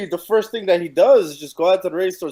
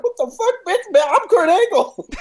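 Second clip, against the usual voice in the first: indignant Kurt; 13 semitones higher, 3 dB louder than usual. Retold or imitated. Mocking Kurt Angle yelling back at Maurice. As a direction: puff up like an offended star.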